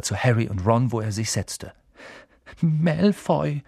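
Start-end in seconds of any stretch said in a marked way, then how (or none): 2.6-3.6 trembling voice. A narrator's reading. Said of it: "sagte Neville mit zitternder Stimme".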